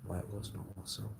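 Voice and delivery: fast, soft and low aside; hushed quick murmur; quick mutter. Acted. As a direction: rush it under your breath. Muttered then repeated loud.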